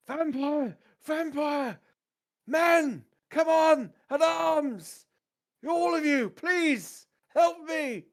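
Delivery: very high, loud panicked shouts; desperate cry for help. Acted. Bloodied captain appealing to his men, desperate shouts. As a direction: yell for your life in short, strained bursts. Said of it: "He is clearly on his last legs, saying".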